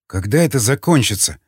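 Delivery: plain reading voice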